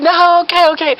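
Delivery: loud, very high, flustered; panicked compliance. Acted. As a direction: give in like a rattled helper. Panicked helper gives in.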